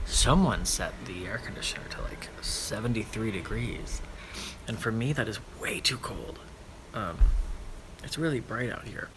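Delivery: soft whispered complaint. Real, not acted. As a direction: whisper it so nobody hears. Whispering outside, keeping quiet.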